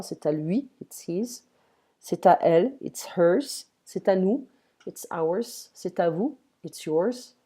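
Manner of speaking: ordinary talk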